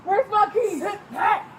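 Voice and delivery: usual speaking voice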